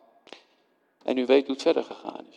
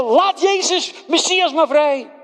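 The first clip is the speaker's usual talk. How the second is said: crowd calling out; 12 semitones higher, 4 dB louder than usual. Acted. Crowd about to call out.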